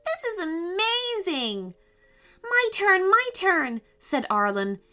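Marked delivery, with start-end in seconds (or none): 0.1-1.7 high, thrilled exclamation; full of wonder. A narrator's reading. Asher thrilled as dog. 2.4-3.8 high, louder eager repeat; bouncing to go next. A narrator's reading. Arlen eager for a wish.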